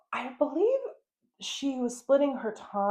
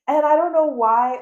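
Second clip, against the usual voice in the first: suddenly louder and higher, exasperated; exasperated outburst; 6 semitones higher, 10 dB louder than usual. Real, not acted. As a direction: burst out in disbelief. Venting about a fake accent.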